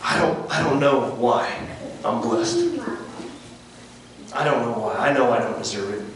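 hushed and low, with long pauses; humbled confession. Real, not acted. Preacher musing on being blessed undeserved, quiet and humbled. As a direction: confess it softly, word by word.

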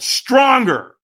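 high, loud coaching push; trainer's demand. Retold or imitated. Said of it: "he went".

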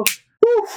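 high breathy puff of relief; relieved sigh. Retold or imitated. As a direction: blow out the tension in one high burst. Relief after training ends.

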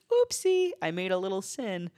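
high mock-cute voice; mocking sing-song. Mocking a careless believer in a high cutesy voice. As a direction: ham up a careless sinner. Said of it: "I'll say it again".

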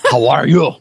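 a light, hammed-up Spanish accent; mock Spanish accent. Guest jokingly greeting the host in a fake Spanish accent. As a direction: try a Spanish accent and mostly miss. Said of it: "I should have a Spanish accent".

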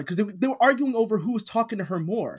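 ordinary talk

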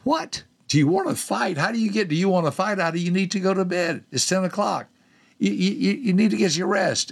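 high, incredulous protest; exasperated disbelief; baffled indignation. Retold or imitated. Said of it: "And I thought".

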